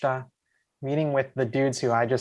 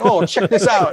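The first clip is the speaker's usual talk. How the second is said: showing off; 6 semitones higher, 6 dB louder than usual. Retold or imitated. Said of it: "he's like".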